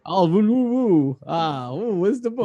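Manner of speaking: hammed-up, high-pitched French accent; mock French accent. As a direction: badly fake a French accent with high woo-woos. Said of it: "I need a French accent".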